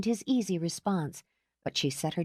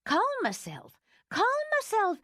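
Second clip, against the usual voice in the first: indignant repetition. A narrator's reading. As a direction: throw the words back in disbelief, climbing higher. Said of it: "calm yourself".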